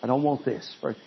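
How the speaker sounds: soft, low, hushed plea to God; quiet prayer. Shown as a demonstration. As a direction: pray it softly, almost under the breath.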